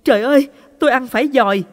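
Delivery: high, loud and fast shriek of disgust; horrified scream. Acted. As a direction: scream it out in panic. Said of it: "bà vội hét lên". (hét lên hoảng hốt)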